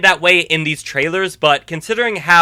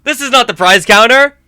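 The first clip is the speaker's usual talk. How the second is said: louder, higher startled disbelief; genuinely thrown by what he sees; real surprise, voice jumping up; surprised; 5 semitones higher, 5 dB louder than usual. Real, not acted. Finding the music box.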